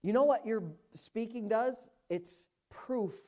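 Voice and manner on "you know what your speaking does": ordinary talk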